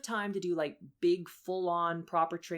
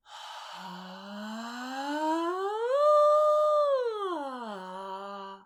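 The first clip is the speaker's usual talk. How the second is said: long breathy slide up high and back down; long breathy glissando; 6 semitones higher, 5 dB louder than usual. Shown as a demonstration. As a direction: stretch a breathy siren up and down. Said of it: "Listen to me here".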